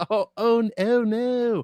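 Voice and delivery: high, stretched-out echoing wail; mock lament. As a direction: join in the fake despair, drawing it out. Co-host echoes the mock lament.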